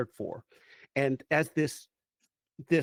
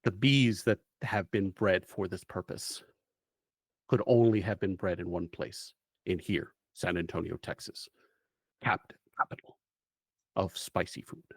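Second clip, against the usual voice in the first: lightly voiced, solemn mission briefing; measured storyteller laying out the mission; game master's briefing; 4 semitones lower than usual. GM scene briefing narration.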